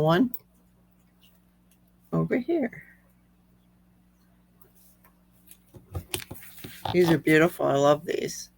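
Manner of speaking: a slightly squeaky, strained voice. Real, not acted. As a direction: voice squeaking a little. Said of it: "Sorry, my voice is squeaky tonight".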